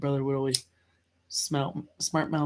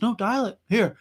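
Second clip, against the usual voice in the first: higher-pitched, insistent command; imitated insistent mom; 7 semitones higher than usual. Mom dares her child to phone CPS, insistent. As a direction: mimic a mother calling the bluff. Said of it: "And she goes".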